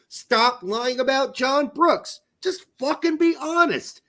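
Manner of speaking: high-pitched, fed-up insistence; exasperated demand. Real, not acted. Host demanding honesty about a dropped player, exasperated. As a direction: plead angrily, leaning on the words.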